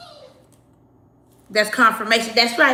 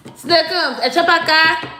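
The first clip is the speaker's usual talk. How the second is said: higher and louder, calling out; calling the pet; 7 semitones higher, 7 dB louder than usual. Real, not acted. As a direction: sing out to the pet across the room.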